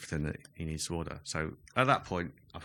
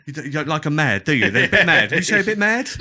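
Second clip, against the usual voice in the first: louder, higher, hammed-up sitcom voice; mock Extras character; 8 semitones higher, 14 dB louder than usual. Retelling an Extras scene to friends, hammed-up louder voice. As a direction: play the nosy guy rifling through bins, loud. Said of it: "He goes".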